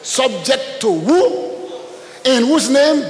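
slow, loud, high proclamation; fervent preaching. Real, not acted. Pastor declaring scripture to the church, slow and loud. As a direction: stretch it out and proclaim.

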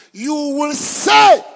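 sudden shouted preaching. Real, not acted. Preacher shouts the promise at the congregation. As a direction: belt it out slowly.

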